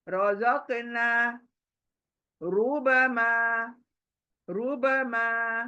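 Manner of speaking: रुबमा slowly, र full and thick; slow model recitation with heavy र. Shown as a demonstration. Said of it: "मोटा पढ़ना है ना". (धीरे पढ़ें, र मोटा)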